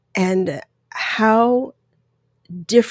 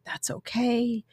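soft, slow imitation of a comforting husband; gentle reassurance; 3 semitones higher, 10 dB quieter, slower than usual. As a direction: soothe her quietly, drawn out. Husband soothing her, retold.

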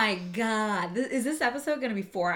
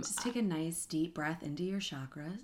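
whispering; 4 semitones lower, 11 dB quieter than usual. Pretend. Jokingly guides a breath.

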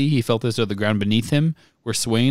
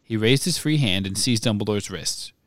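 usual speaking voice

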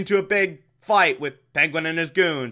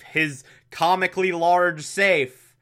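ordinary talk

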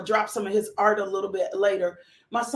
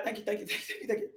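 laughing; 10 dB quieter, much faster than usual. Real, not acted. Laughing thanks over the coffee.

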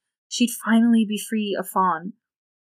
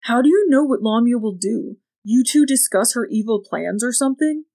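louder, mocking questions; hint of a loud taunt; boy mocking adults, raised voice; loud challenge; 4 semitones higher, 6 dB louder than usual. A narrator's reading. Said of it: "didn't bother to lower his voice".

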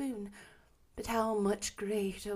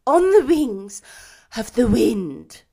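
mournful ghost; 2 semitones higher, 15 dB louder than usual. A narrator's reading. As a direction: lament like a chained spirit doomed to wander. Ghost answers Scrooge.

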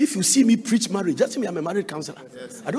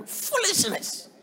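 high, drawn-out scoff; scornful exclamation; 12 semitones higher, 3 dB quieter, much slower than usual. Acted. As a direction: stretch it out high in disdain. Scornfully dismissing reopening the case.

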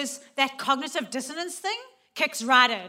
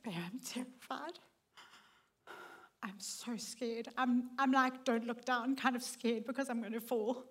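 soft, hushed, frightened confession; barely voiced fear, told to her husband; scared confession to husband; 8 dB quieter than usual. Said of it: "I turned to Richard and I said".

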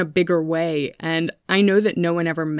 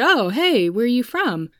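high, louder mock-casual hello; friendly greeting imitated; 6 semitones higher, 4 dB louder than usual. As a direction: imitate someone striking up a chat, pitched up. Friend's first greeting acted out.

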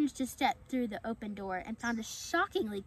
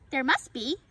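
louder, lightly raised squirrel voice; high-pitched voice; 3 semitones higher, 7 dB louder than usual. A narrator's reading. Squirrel contradicting the fawn, loud and lightly high-pitched. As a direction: pipe up with mild squeakiness. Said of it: "The high-pitched voice filled the room".

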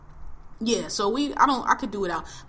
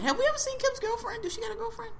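teasing; 13 semitones higher, 5 dB quieter, faster than usual. Real, not acted. Teasing question about Kim.

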